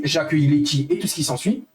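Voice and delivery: ordinary delivery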